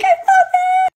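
high excited squeal; delighted squeal. Real, not acted. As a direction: squeal with joy at the result. Delighted with the lights.

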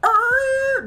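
very high sustained skid noise; imitated tyre screech. Driver sees a semi skirting up behind, high screech. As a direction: mimic a truck skidding to a halt. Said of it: "I see him skirting".

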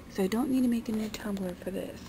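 usual speaking voice

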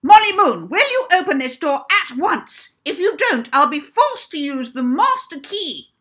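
high, loud barking matron; fierce bark. Acted. Orphanage matron shouting at Molly through the locked door. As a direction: bellow it through the door like a furious warden. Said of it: "came with a fierce bark".

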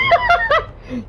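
squealing giggle fit; high-pitched laughter. Real, not acted. Gamer cracking up at own play, high-pitched laughter.

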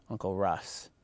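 light lisp on the s, imitating; soft lisped Russ; little lisp. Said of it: "you gotta say it with a little lisp".

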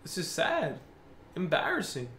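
low, soft, deflated mumble; quietly dejected. Real, not acted. Gamer after packs with no opal, quietly dejected. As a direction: say it softly, all energy gone.

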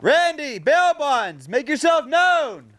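high, slow call across the property. Real, not acted. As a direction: stretch the call out toward the house. Calls out again.

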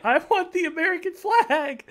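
high, loud, drawn-out plea; slow, whining high demand; whiny demand. Real, not acted. Demanding the flag while laughing.

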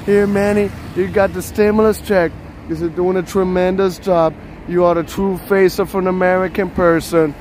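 put-on Trump speech voice; Donald Trump. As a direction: do Trump proclaiming praise to a supporter. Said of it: "Manny Trump".

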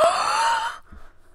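high delighted gasp. Real, not acted. Sees the new villager.

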